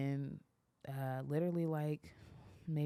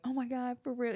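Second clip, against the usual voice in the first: imitating excited partner; 9 semitones higher than usual. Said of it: "He's, like, so excited".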